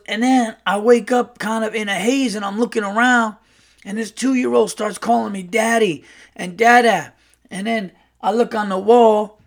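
animated, confused brash guy; Earl. Retold or imitated. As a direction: imitate a brash storyteller piecing together a hazy morning. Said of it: "like, a very brash uncle".

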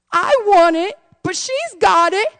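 imitated child pleading in rising pitch; crying little girl. Seven-year-old daughter begging her mom, imitated crying. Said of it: "and she's crying".

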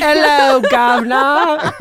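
loud, high mock British greeting; hammed-up British accent. Friend mocking a failed Scottish try, hammed-up British accent. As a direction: belt out a cartoonish British hello, laughing. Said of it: "He just got British".

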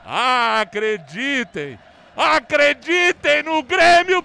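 impassioned plea. Real, not acted. After goal, commentator urging fans.

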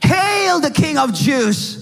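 high, jeering mock praise; mocking hail. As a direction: hail him with scorn. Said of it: "And now they said".

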